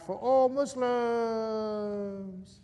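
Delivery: slow, high heroic call; cries out. Acted. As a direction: stretch it out like a noble sacrifice. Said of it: "jumps out crying out".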